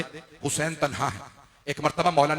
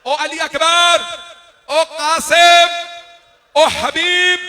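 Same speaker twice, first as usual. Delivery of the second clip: high, full-voiced wailing calls; calling names out; 12 semitones higher, 13 dB louder, much slower than usual. A narrator's reading. Imam calls his fallen companions by name, crying out. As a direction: cry each name long, high and loud. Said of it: "फिर मौला ने नाम लिए".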